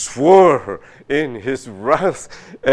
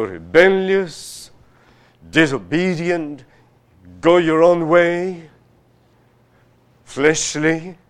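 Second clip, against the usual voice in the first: higher and louder, slow list; preacher building a rebuke; forceful preaching; 6 semitones higher, 4 dB louder, slower than usual. Acted. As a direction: drive each sin home. Preacher listing sins forcefully.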